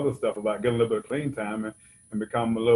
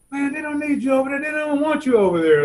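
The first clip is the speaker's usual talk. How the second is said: much higher mock scolding kid voice; high, mocking teen voice scolding; imitating the kid; 13 semitones higher, 5 dB louder than usual. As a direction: pitch way up to play the teen. Retold kid scolding the speaker.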